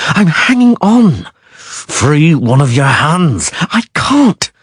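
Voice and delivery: high, strained pleading; strained cries. A narrator's reading. Yennefer and Geralt straining while hanging from the bridge, high strained voices. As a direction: force the words out while dangling. Dangling sorceress and Witcher strained.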